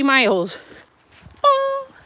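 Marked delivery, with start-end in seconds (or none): 1.4-1.9 high mimicked warning chime; ping it out like a dashboard alert; imitating the car's fuel warning bong.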